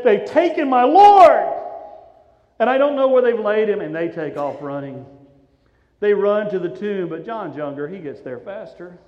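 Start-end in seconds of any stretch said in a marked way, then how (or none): 0.0-3.8 loud, high cry of alarm; anguished outcry. Acted. Mary breaking the news to Peter and John, anguished outcry. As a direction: cry it out, stretching the loss. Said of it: "She said".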